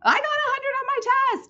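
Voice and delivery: teacher demonstrating an excited exclamation. Shown as a demonstration. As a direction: announce it like great news. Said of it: "Do you see how I'm really excited about everything".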